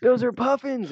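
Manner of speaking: very high, loud, giddy cry. Retold or imitated. Calling out to the boat a second time, a very high giddy yell. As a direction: yell it again, totally sure. Repeats, even more thrilled.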